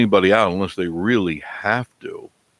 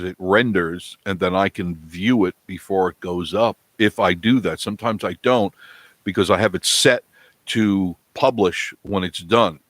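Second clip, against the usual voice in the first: normal talking voice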